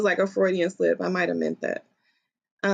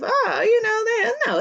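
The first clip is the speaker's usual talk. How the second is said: much higher, hammed-up brushing-off voice; mock father; 14 semitones higher than usual. Daughter imitating her father making light of it, mock-airy. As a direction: mimic him laughing it all away. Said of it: "make light of it to me as if".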